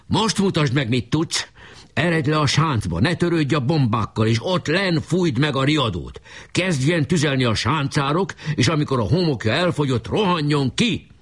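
high, urgent commands; shouted orders. A narrator's reading. Leader orders his comrade to the trench, calling high. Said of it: "kiáltott Boka".

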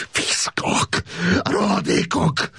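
high, slow cry of insult breaking into tears. A narrator's reading. Prisoner cursing the officers as he breaks into tears, crying. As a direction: spit the insults as the tears come. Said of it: "Sírva fakadt".

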